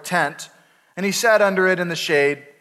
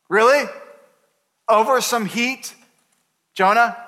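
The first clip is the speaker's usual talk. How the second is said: high, louder mock disbelief; incredulous; 5 semitones higher, 3 dB louder than usual. Real, not acted. Pastor mocking Jonah for sulking over heat, incredulous. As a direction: scoff at Jonah's overreaction. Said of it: "mocking disbelief".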